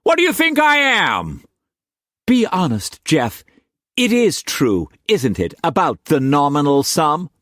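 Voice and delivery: put-on high, pressing young woman; young woman confronting. A narrator's reading. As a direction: demand the honest answer, high.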